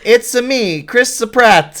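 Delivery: put-on hammy Mario-style Italian accent; a playful jab at a Mario accent; fake Italian accent. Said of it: "do, like, a fake Italian accent".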